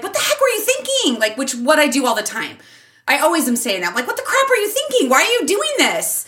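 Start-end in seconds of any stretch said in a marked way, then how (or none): 0.0-1.2 high-pitched exasperated outburst, acted; losing it. Mom imitating herself scolding her son, losing it. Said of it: "getting mad and kinda losing it". 4.1-6.2 high, quick exasperated scolding; loud and reactive. Retold or imitated. Mom imitating how she reacts to kids, exasperated. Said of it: "a little bit loud and reactive".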